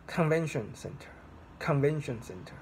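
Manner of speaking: CONVENTION center, first word pitched high; stress on first word. Shown as a demonstration. Teacher showing how to stress the phrase, high stress on "Convention". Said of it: "you should stress on the first word".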